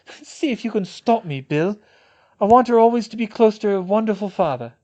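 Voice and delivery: high, loud, defiant mother; determined new mother Fleur. Acted. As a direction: stand your ground over the baby's name.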